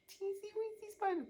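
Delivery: put-on squeaky high teensy voice; tiny voice. Shown as a demonstration. Host demonstrates a tiny spider voice for kids, high and squeaky. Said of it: "a teensy weensy voice just like this".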